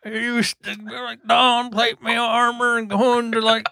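high, put-on old-timer voice; retired old adventurer. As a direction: reminisce like an elderly ex-adventurer.

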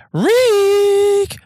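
high squeal. Real, not acted. Proud self-naming punchline.

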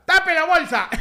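high mock yell with a laugh; imitated shout. Imitating the chifa man yelling at a customer, mock shout. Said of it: "cuando me grita".

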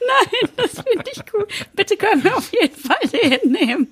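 giggling, high and tipsy; laughing. Real, not acted. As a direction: talk through the giggles. Laughing drunk cohost.

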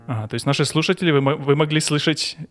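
ordinary talk, nothing marked

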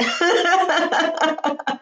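high, bright laugh breaking the tension; sudden real laugh; burst of laughter. Real, not acted. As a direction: laugh at yourself. Laughs at own heavy mood.

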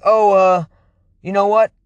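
clueless worker. Retold or imitated. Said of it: "the person's like".

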